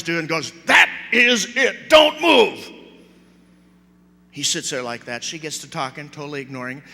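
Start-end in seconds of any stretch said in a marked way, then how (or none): 0.6-2.7 loud, high mock scolding; exasperated mother. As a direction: lay down the law as a fed-up mother, word by word. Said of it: "the mother grabs the little boy and sits him down".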